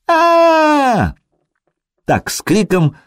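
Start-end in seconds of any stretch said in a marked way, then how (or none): 0.1-1.1 high thin shriek, loud; high thin cry. Acted. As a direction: join in with a thin squeal. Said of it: "подхватила высоко и тонко".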